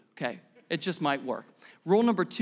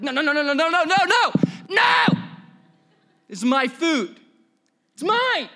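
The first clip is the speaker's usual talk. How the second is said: high, loud volley of refusals; possessive protest; 8 semitones higher, 6 dB louder than usual. Acted. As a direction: shout it like someone guarding their plate. Acted panicked refusal over taken food.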